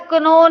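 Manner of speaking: KNOW said as "कनो", with the k sounded; mispronounced know. Retold or imitated. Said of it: "के silent होता".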